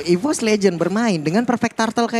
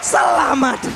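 fighting game announcer; 9 semitones higher than usual. Real, not acted. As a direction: call the teamfight at full energy. Live hype play-by-play of teamfight.

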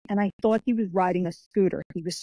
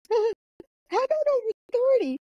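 much higher, slow mock voice of the old coach; teasing coach; slower than usual. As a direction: tease them with a high, drawn-out jab. Mimicking coach teasing about age.

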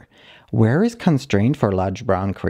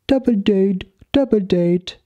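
sing-song owner; 10 semitones higher than usual. Retold or imitated. Owner announcing the double date in a high sing-song. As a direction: chant it like a gleeful little tune. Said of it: "man monster makes noise like".

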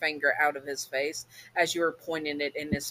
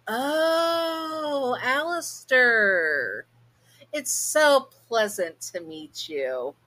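slow, drawn-out fake-sweet greeting; forced politeness; 7 semitones higher, much slower than usual. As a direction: stretch every word through a strained smile. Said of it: "You stretch a smile".

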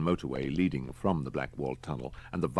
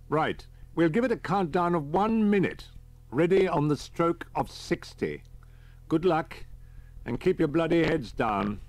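lightly voiced, higher soldier on the radio; brisk countdown orders; captain Peters; 12 semitones higher, 5 dB louder than usual. A narrator's reading.

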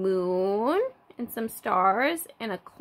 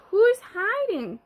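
higher and louder sing-song question; playful book voice; 8 semitones higher, 5 dB louder than usual. Retold or imitated. Teacher reading a peekaboo flap to toddlers, playful and high. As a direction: read it like a peekaboo game. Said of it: "It says".

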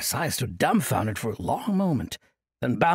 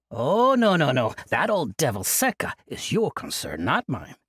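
put-on jovial old monk. Old monk cheerfully refusing Kasai's plea, jovial. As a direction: laugh off the burden like a merry elder.

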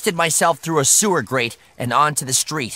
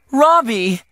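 high, louder call from a shocked mother; surprised, raised voice; startled cry; 8 semitones higher, 5 dB louder than usual. A narrator's reading. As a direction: cry the name in alarm. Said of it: "she cried".

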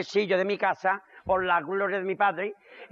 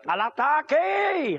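battle cry; 4 semitones higher, much slower than usual. Acted. Torero charging the bull.

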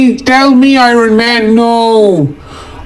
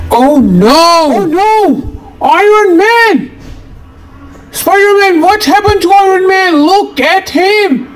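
much higher, panicked little cries; alarmed hero cry; 8 semitones higher than usual. Acted. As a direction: gasp out in shock at the fallen friend. Hero sees friend hurt.

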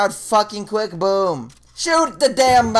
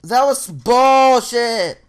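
slow angry complaint; 5 semitones higher, 5 dB louder, much slower than usual. Real, not acted. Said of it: "Hope you enjoyed my little rage".

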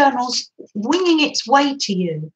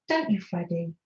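softer and lower, cooing to a pet; soft to the dog; 6 semitones lower, 9 dB quieter than usual. Real, not acted. Teasing the dog Freddie about snack time, softly cooing. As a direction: gently tease the dog.

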